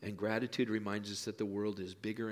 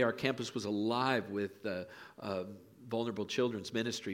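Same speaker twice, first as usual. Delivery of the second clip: usual speaking voice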